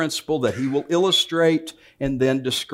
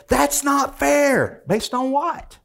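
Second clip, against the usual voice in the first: put-on very high, slow child's complaint; child cheated of a fudge round, mock whining; whiny kid; 12 semitones higher, much slower than usual. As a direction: whine like a kid denied a treat. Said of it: "watch the other one go".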